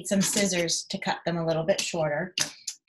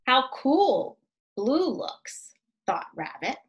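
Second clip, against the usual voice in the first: slow, lilting storybook reading; reading to children; 4 dB louder, slower than usual. A narrator's reading. Reading a rabbit story aloud to young children, gently lilting. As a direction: read it like bedtime story time. Reads picture book aloud.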